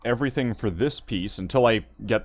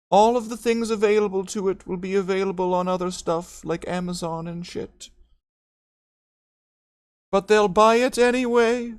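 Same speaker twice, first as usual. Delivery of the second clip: mock corporate voice; 12 semitones higher, 10 dB louder than usual. Mocking Games Workshop executive voice.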